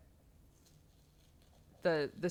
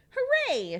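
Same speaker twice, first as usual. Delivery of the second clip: very high, loud joyful shout; shouted hooray; 6 dB louder than usual. Acted. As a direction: cheer it out together. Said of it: "shouted Little Beaver".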